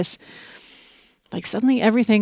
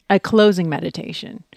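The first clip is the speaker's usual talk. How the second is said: normal talking voice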